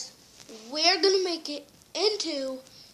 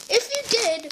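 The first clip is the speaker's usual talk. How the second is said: ordinary delivery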